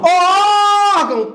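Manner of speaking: high, loud call on órgão; long drawn-out shout. Shown as a demonstration. Teacher hollering an example word to the class, stretched shout. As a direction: holler órgão, holding the first vowel.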